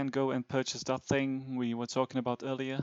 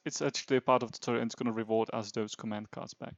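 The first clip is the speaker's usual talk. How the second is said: ordinary delivery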